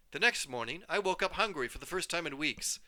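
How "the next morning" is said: ordinary delivery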